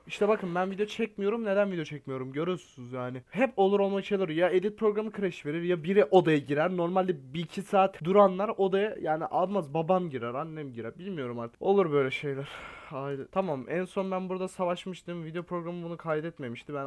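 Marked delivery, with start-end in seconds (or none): none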